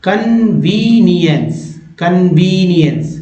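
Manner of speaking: drawn out, syllable by syllable; slow syllable reading. Shown as a demonstration. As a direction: spell it out slowly by sounds. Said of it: "मात्र चार बार में पढ़ा गया". (धीरे धीरे टुकड़ों में)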